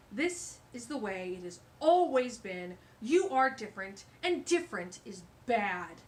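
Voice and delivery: loud, puffed-up boasting voice; pompous stink bug. Acted. Stink bug lecturing the animals, loud and pompous. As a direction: boom out his own importance. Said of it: "said the stink bug".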